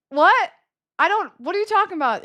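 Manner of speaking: high, quick baffled protest; retold confusion. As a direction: replay your bewildered reaction. Acts out her confusion.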